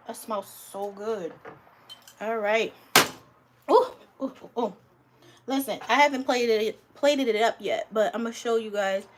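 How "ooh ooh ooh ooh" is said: excited oohs. Real, not acted. Reacting to food sizzling.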